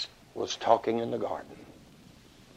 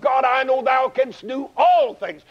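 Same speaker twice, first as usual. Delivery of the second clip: crying out; 11 semitones higher than usual. Acted. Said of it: "he cries out".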